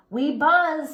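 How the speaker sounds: put-on baby bees answering; little bees buzzing. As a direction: buzz it like tiny bees. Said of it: "said the five".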